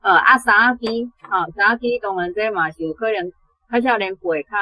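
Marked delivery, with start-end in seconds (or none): none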